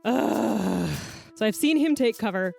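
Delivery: normal talking voice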